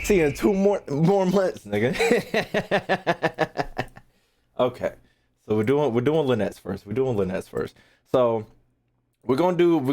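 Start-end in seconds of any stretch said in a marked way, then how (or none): 2.0-3.8 a short laugh. Real, not acted.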